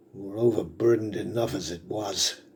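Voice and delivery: ordinary talk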